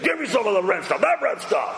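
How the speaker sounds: high, crude blurt, last words jumping way up; grab-it-now hungry oaf; brutish Esau. Retold or imitated. Preacher acting Esau begging Jacob for stew, crude and high. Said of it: "It's brutish".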